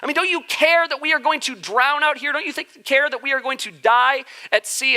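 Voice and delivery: begging like they're about to drown; panicked plea. Retold or imitated. Disciples pleading with sleeping Jesus, high panicked plea. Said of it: "They're like".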